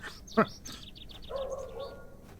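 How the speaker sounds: high unvoiced giggle; breathy high laugh. Real, not acted. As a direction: laugh at your own joke. Laughs at own joke.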